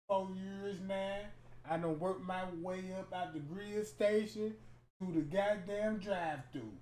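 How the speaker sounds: lightly voiced timid guy, low and soft; meek man introducing himself, mildly put on; polite introduction. Comic shows a shy date introducing himself, meek and soft. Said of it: "Introduce himself and give you his real name".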